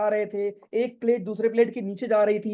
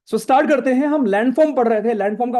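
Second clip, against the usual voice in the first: energetic start; 3 semitones higher, 7 dB louder than usual. Real, not acted. Teacher launching the lesson.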